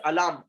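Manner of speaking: alarm as "a-laam" with no R; silent R. Shown as a demonstration. Teacher confirming the right form, alarm as "a-laam". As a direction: stretch the second vowel of alarm, R left out. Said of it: "R is silent".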